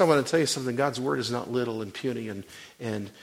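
normal talking voice

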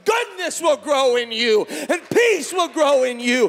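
high, loud, driving sermon shout; full-force preaching. Real, not acted. Preacher promising believers that goodness will grow, full-force shouted preaching. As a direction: shout it out as a mounting promise to the crowd.

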